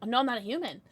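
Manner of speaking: normal talking voice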